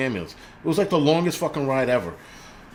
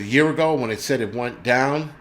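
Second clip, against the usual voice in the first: normal talking voice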